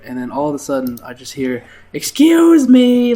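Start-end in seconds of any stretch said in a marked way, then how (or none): 1.9-3.1 high, slow, loud cry for attention; calling out loudly. Retold or imitated. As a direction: stretch out each word as a shrill call across the room. Old lady calling out to waiter.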